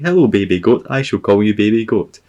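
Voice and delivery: put-on high, loud greeting to a baby animal; mock cooing. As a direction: gush playfully at a tiny goat. Acted greeting to baby goats.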